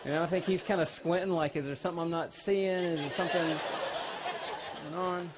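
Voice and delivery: loud, driving preacher's storytelling; preaching at full force. Acted. Preacher to congregation, loud full-force delivery. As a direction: preach it big to the crowd.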